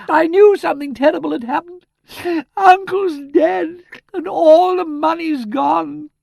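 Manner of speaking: hysterical high wail, slowing and stretching out; reckless shriek. A narrator's reading. Niece lamenting dead uncle and lost money, hysterical shriek. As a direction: shriek it out, drawing out each word. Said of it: "shrieked Miss Twitterton recklessly".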